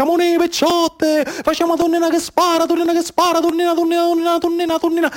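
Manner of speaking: put-on high sing-song call of young girls in the water; playful girls' shout across the rocks; children calling out. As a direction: call your friends to the game, high and bright. Girls calling to play at sea.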